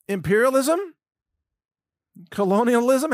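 scoffing at the Polish prime minister's words; incredulous. Real, not acted. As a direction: repeat it like you can't believe your ears. Incredulous echo of PM's words.

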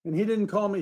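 ordinary delivery

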